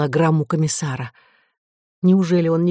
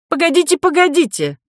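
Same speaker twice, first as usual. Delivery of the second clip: urgent interruption; 11 semitones higher, 4 dB louder than usual. A narrator's reading. Professor urgently stops departing guest.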